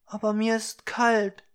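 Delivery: put-on a cold child asking for pity; pleading little girl. Little girl telling Blaugrün she is cold, high and plaintive.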